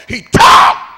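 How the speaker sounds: explosive shout on TAUGHT; full-force, high preacher's cry; preaching shout. Real, not acted. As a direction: blast the word out from the pulpit. Preacher climaxes on key word.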